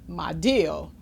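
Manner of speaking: ordinary delivery, nothing marked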